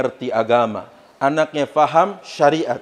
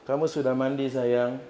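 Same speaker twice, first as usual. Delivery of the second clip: tender asking; 3 semitones lower, 8 dB quieter than usual. Retold or imitated. Husband asking wife sweetly.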